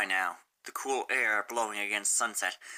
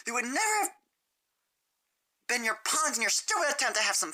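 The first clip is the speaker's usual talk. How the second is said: furious shout; 14 semitones higher than usual. Acted. Rainbow Dash screaming at Sunset to leave. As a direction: tear into her.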